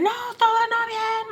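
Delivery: very high, drawn-out protest; retold angry outburst. Answering the caller that all is not well, high angry retort. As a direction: wail the denial slowly, acting it out.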